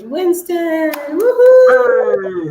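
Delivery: loud, high cheering welcome; excited call. Real, not acted. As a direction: call him up with a big cheer. Host calls guest onto stage.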